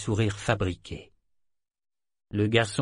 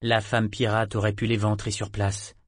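ordinary delivery, nothing marked

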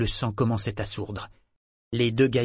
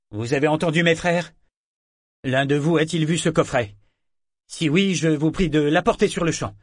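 loud, higher sanctimonious appeal; affected; 5 semitones higher, 5 dB louder than usual. Acted. Priest addressing the crew, affected. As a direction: preach goodness you do not mean. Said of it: "L'affectation était si transparente".

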